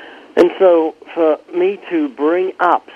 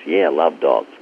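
much lower, lightly voiced student; casual voiced reply from a college student; student answering; 11 semitones lower than usual. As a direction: drop the voice low to play the student. Student answering about the dog.

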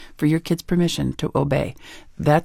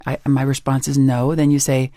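usual speaking voice

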